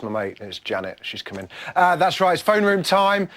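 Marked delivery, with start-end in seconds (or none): none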